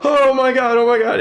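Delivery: loud, very high panicked wail; shaking, shocked. Acted. Boy thrown by the raptor, shaking high-pitched panic. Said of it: "he's shaking".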